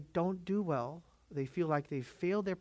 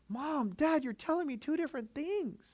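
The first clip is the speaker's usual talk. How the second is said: put-on high childish whine; kids complaining; 12 semitones higher than usual. Kids complaining to mom and dad in a high whine. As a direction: whine like a confused kid. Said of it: "they go".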